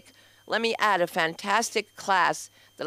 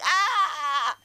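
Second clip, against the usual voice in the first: exasperated yelp; 9 semitones higher than usual. Retold or imitated. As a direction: let out a fed-up shriek. Reacting to friends' stupid remark.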